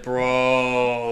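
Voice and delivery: a lightly voiced stoned kid; mock stoner drawl on Bro. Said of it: "some kid was high on weed, said".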